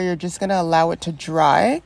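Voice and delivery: ordinary delivery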